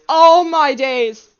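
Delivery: loud dismay. Real, not acted. Frustrated at confusing sentence.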